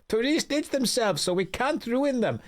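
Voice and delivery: a hammed-up German sailor; German accent. Sailor grumbling about priorities to the player, in a mock German accent. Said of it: "Now we're going German".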